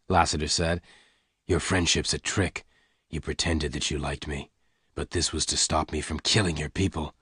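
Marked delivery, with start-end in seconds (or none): 1.5-7.1 low, soft, restrained reproach; bitter gunman. Acted. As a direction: accuse her quietly in a low man's voice. Lassiter accuses Jane.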